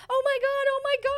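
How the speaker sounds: high-pitched squeal of "oh my God", reliving the shock; retold scream. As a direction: shriek it way up high, half-laughing disbelief. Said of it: "I screamed".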